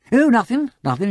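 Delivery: high, placating gentleman; nervous backtrack. A narrator's reading. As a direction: back off fast from an angry host. Nervous visitor hastily backs off.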